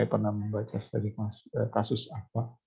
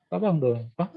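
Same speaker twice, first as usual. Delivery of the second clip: impatient retort; 6 semitones higher than usual. Retold or imitated. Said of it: "menjawab orang tua".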